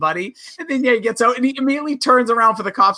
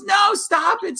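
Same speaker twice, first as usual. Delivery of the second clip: mock frantic shout; 12 semitones higher, much slower than usual. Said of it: "She hops over and goes".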